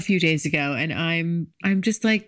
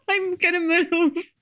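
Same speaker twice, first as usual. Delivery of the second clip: giddy excited; 10 semitones higher than usual. Real, not acted. Announcing her big move.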